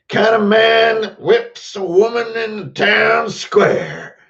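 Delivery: high, loud, long drawn-out words; hollered tale. Acted. Storyteller on a kind of man, hollering high. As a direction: holler it slowly, stretching the key words.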